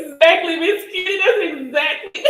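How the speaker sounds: high, laughing exactly; laughing agreement. Real, not acted. Agreeing with a viewer, laughing and high.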